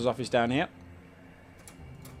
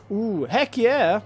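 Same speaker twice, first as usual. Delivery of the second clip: excited cheer; 9 semitones higher, 10 dB louder, much slower than usual. Acted. Eager reply to the quest.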